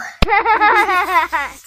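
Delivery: put-on high toddler giggle; bubbling little-kid giggles. Two little brothers giggling together, high and loud. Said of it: "George and Richard both giggle".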